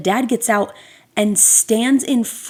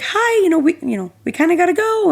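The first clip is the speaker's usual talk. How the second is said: much higher, sing-song polite greeting; sweetly insisting they must leave; extra nice; 9 semitones higher, faster than usual. Retold or imitated. Mom politely pressing the dad to hurry, high and sweet. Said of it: "she was much nicer than me".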